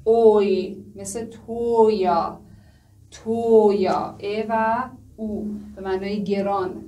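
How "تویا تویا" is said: تویا very slow with a clear "oy" glide; "oy" sound demonstrated. Shown as a demonstration. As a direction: stretch the "oy" in تویا for learners.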